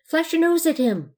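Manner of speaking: high, voiced urgent prompt; urgent stage whisper. A narrator's reading. Pirate prompting Blanche, urgent stage whisper. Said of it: "whispered the pirate".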